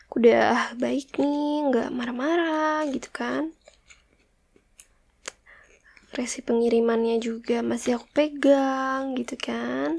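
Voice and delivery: breathy unvoiced coaxing whisper; soft whisper. Wife gently pressing her husband about a parcel, whispering. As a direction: whisper it sweetly, as if keeping a secret.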